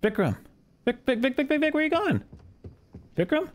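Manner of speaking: high-pitched urgent calling. Real, not acted. Calling after a fleeing player.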